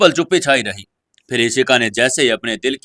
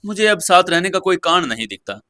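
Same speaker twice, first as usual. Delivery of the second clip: firm; 6 semitones higher than usual. Acted. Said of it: "उसका स्वर बिल्कुल दृढ़ था".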